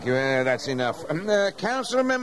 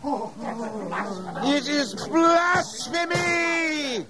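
outraged shout; 7 semitones higher, much slower than usual. Acted. High priest condemning Jesus, slow outraged shout. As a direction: hurl the accusation at the accused.

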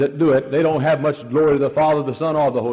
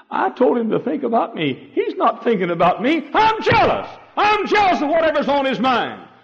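higher, louder, rising to a cry; jealous Jesus; 14 semitones higher, 4 dB louder than usual. Acted. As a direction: plead as the jealous Lord. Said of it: "And Jesus said".

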